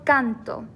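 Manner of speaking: "canto" with a stronger first syllable, CAN-to; stress on CAN. Shown as a demonstration. Teacher showing natural stress on second-to-last syllable, CAN stressed in "canto". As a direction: lean on "can" in "canto", let "to" fall lighter. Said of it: "can was a little bit stronger".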